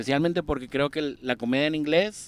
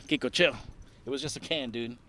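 ordinary talk

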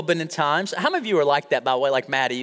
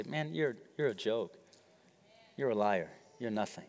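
mocking taunt; 7 semitones lower, 13 dB quieter than usual. Taunting the devil in church.

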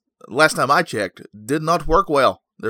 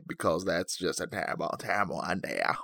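low, soft put-on voice; terrible made-up character; 7 semitones lower, 10 dB quieter than usual. Host mocking his own impressions, made-up character voice. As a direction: sink into a daft character voice. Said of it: "And he's doing it again".

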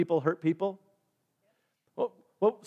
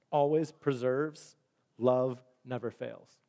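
solemn Bible recitation, softer toward the end; scripture reading; 3 semitones lower, 3 dB quieter than usual. Acted.

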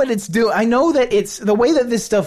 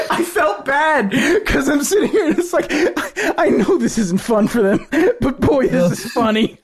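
laughing; 8 semitones higher than usual. Real, not acted. Laughing about the video.

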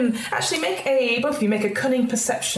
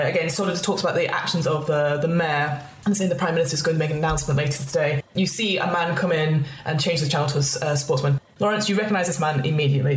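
normal talking voice

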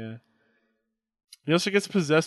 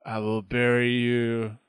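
Undertaker voice; 2 semitones lower, 3 dB quieter, slower than usual. Pretend. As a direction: growl it slowly like the Dead Man. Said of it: "a Undertaker versus Pennywise match".

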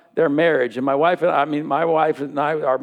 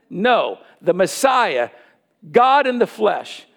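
usual speaking voice

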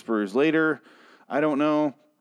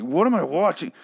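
exasperated outburst, pitched up; mock-outraged disbelief, voice leaping higher; retold anger; 6 semitones higher than usual. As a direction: replay your angry reaction to the screen. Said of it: "I was fucking angry".

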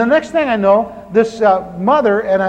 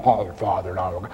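mock prayer; 14 semitones lower, 9 dB quieter than usual. Mocks churchgoers' prayer.

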